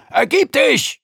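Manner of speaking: loud, high threatening command; loud threat. Acted. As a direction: bellow it as a threat. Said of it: "so laut und drohend er konnte".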